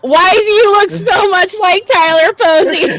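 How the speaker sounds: high, laughing read-out. Real, not acted. Reading a silly option to co-player, laughing. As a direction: crack up while reading it aloud.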